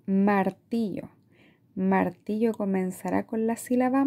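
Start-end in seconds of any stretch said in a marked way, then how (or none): 0.1-1.1 slow, syllables apart; slowly segmented. Shown as a demonstration. Teacher modelling a word, slow and syllabic. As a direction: say Martillo beat by beat. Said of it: "vamos a ir segmentando".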